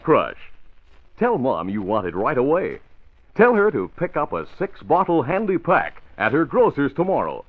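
put-on upbeat old radio pitch to kids; chipper 1950s ad. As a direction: sell it to the kids with a grin.

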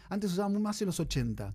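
ordinary delivery

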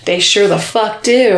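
usual speaking voice

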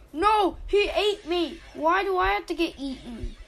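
high, loud protesting whine; mock outrage. Real, not acted. Player's avatar just got eaten, high mock outrage. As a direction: complain as if truly wronged.

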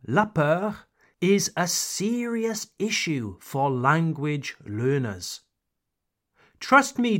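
normal talking voice

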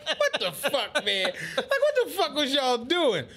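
high, laughing outrage; laughing disbelief. Real, not acted. Reacting to the blowout comeback with laughter.

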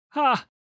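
short, sharp snort of a laugh. A narrator's reading. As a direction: snort it out, amused. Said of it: "snorted Alawi".

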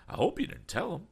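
put-on high, fussy old gentleman voice; flustered, eager old man; eccentric old gentleman. A narrator's reading. Mister Damon voiced.

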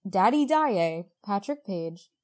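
normal talking voice

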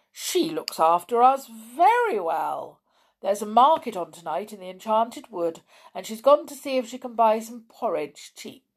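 fast, cheerful bear voice; lightly voiced kind bear; gentle bear person. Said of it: "said the father bear".

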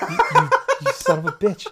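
high, loud, laughing outburst; mock outrage. Gamer cursing Justin over Last Guardian, mock outrage. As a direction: squawk it in playful indignation.

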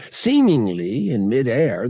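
plain reading voice

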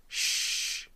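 long unvoiced shh; hushing shush. Man silencing the girl he grabbed, hushing shush. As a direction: shush someone urgently.

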